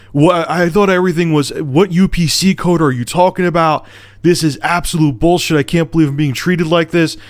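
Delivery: high-pitched outraged rant, not full volume; retold angry screaming. Angry customer rants at phone rep over returned item, retold screaming. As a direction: re-enact losing it, pitch pushed up. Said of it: "I started screaming".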